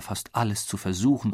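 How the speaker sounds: ordinary delivery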